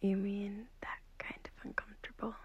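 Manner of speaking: whispering. Acted. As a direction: whisper so the sleeper beside you doesn't wake. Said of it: "I'm whispering because I don't want to wake up".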